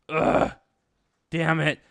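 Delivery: loud groan, then curse; frustrated cursing. Retold or imitated. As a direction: groan and swear in mock frustration. Prince's frustration acted out.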